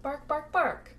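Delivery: playful, high-pitched word barks; spoken dog bark. Pretend. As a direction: mimic a friendly dog in words. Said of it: "started barking".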